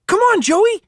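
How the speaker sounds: put-on high, bright kid's call to a friend; a young boy urging his buddy on; eager boy calling. Boy hurrying his best friend along, eager call.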